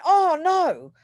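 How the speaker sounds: retold shock, high and loud; alarmed at bad news from home; dismayed gasp. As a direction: blurt "Oh, no." in alarm. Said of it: "So I went".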